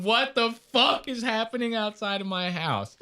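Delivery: high, startled neighbor; alarmed neighbor. Retold or imitated. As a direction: play the panicked neighbor. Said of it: "they're going".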